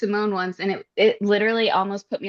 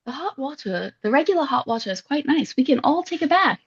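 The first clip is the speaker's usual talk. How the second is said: higher-pitched, lilting British impression; British accent; 3 semitones higher than usual. Quoting a woman about hot baths, in a mock British accent. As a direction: put on a playful British accent. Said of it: "I can't stop doing a British accent".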